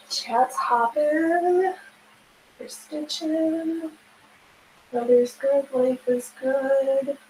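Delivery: slow soothing list. Acted. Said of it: "Okay, relax".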